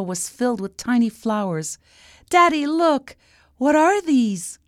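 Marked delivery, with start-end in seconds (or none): 2.3-4.6 put-on high child's excited call; high-pitched little girl, amazed; amazed princess. A narrator's reading. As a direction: call Daddy over in wonder. Princess amazed by tiny flowers.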